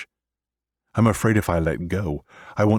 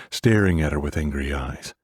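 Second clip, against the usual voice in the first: ordinary delivery, nothing marked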